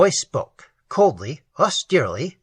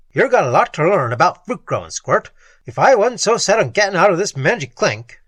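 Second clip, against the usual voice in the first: gruff tough guy; 4 semitones higher, 5 dB louder than usual. A narrator's reading. Gruff Spike insults.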